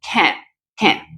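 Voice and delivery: "can" with a full, open vowel; stressed full can. Shown as a demonstration. Teacher showing the emphasized "can" to students. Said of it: "voy a utilizar esta pronunciación".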